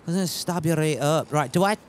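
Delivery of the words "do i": ordinary delivery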